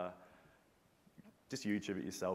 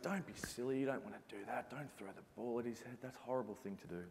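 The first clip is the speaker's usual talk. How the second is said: softer, gently reasoning voice; a teammate's quiet voice of reason; calm voice of reason; 2 semitones higher, 5 dB quieter than usual. Retold or imitated. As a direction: talk him down quietly, like a conscience. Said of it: "Then Brad said".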